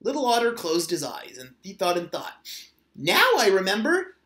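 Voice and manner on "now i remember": louder, higher, eureka burst; sudden realization. A narrator's reading. Little otter suddenly remembering where he hid the cone, excited. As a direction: it just came back to him.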